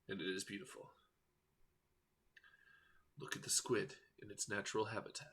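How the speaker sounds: hushed, reverent wildlife narration; nature documentary. Pretend. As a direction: narrate the squid like a nature film.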